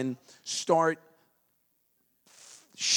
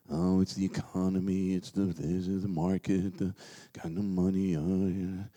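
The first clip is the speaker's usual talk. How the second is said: lower, quieter mock excuses; mock excuse-maker; 8 semitones lower, 7 dB quieter than usual. Mocking people blaming the economy, quiet mock. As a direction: sneer through their excuse. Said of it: "buy some other B S story".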